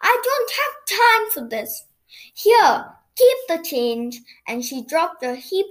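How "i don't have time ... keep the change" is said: high, hurried-sounding storybook exasperation; raised pitch, mildly exasperated; impatient cry. A narrator's reading. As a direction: cry it out like a flustered customer. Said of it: "cried Missus Skittle".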